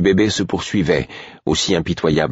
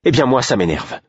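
high-pitched irritated outburst; combative exclamation; 7 semitones higher than usual. A narrator's reading. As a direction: fire back, ready for a fight. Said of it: "s'exclama Molly en se retournant, prête à se battre". (exclamation énervée, combative)

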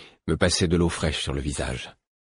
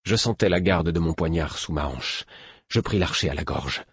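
ordinary delivery, nothing marked

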